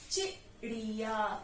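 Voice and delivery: चिड़िया slowly split, short "chi", long "ri-yaa"; syllable by syllable. Shown as a demonstration. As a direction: sound out चिड़िया for spelling. Teacher sounds out word for spelling.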